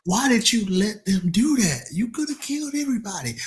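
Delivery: high, exaggerated scolding voice; mock self-scolding. Scolding himself for a wasted dream, high mock voice. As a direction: chew yourself out in a silly high voice.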